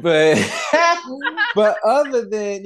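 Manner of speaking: laughing. Real, not acted. Host trying to get back to podcast plugs, laughing. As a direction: still giggling, high-pitched restart.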